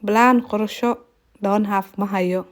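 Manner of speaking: ordinary talk, nothing marked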